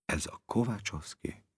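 "Ez a Kovácsovszki?" mumbled softly and unsure; uncertain mumble. A narrator's reading. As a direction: mumble the question into your chest. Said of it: "bizonytalan hangon motyogta maga elé".

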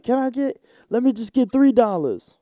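humble beggar. Retold or imitated. Comedian voicing a beggar asking only three dollars, high and loud. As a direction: pitch it high like a cheap deal. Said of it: "You gotta be like".

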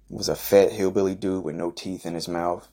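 ordinary delivery

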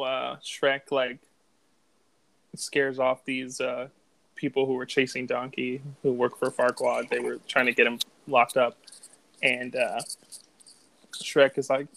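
usual speaking voice

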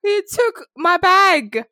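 high, loud, drawn-out disbelief; outraged retelling. Retold or imitated. Retelling being thrown out, outraged.

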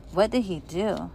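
usual speaking voice

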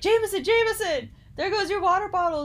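high, loud calling to a friend. Retold or imitated. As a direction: call his name loud and high. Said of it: "And I was like".

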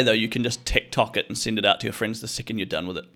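ordinary delivery

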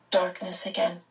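ordinary delivery

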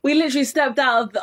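ordinary delivery

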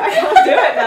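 loud, high, laughing protest; helpless giggly refusal. Real, not acted. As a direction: squeal it out through laughter. Embarrassed when asked for accents.

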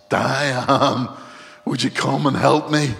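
acted urgent shout; crying out for help. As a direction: yell for help from across the house. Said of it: "cried out all the time".

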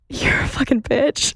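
hushed whisper. Retold or imitated. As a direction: whisper it under your breath. Trainee's hushed reaction.

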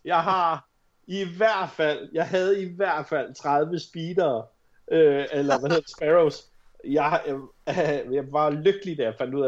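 ordinary delivery

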